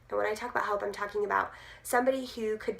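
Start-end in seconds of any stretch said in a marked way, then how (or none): none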